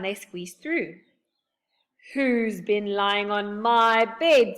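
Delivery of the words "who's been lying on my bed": slow, loud growling bear; slow growly father bear. A narrator's reading. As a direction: boom it like a big bear. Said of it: "in a growly voice".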